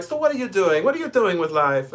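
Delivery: impersonating a kindly retired professor; professor's voice. Retold or imitated. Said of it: "I use this voice, this is literally how he sounds".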